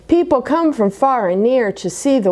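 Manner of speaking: ordinary delivery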